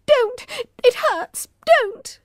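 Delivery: a touch of high whimpering; whimper, fending off her hands; mild whimper. A narrator's reading. Emma mildly whimpering at Poppy to stop.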